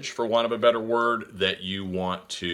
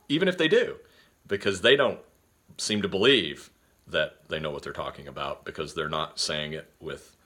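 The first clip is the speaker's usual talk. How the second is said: ordinary delivery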